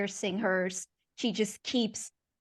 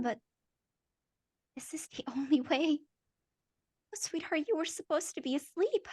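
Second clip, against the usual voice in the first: soft, higher, eerily sweet apology; unhinged mother; 7 semitones higher, 4 dB quieter than usual. Acted. Mother caught with the knife, creepily tender. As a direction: soothe her child while holding a knife. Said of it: "turns around and says".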